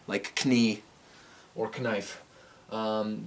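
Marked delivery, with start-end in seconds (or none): 0.3-0.8 "Knie" with a sounded K; "Knie" opening on an audible kuh-; K pronounced. Shown as a demonstration. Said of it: "if you say Knuth, like". 1.8-2.2 "Knife" with a sounded K; "Knife" opening on an audible kuh-; K pronounced. Shown as a demonstration. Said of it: "if you say Knuth, like".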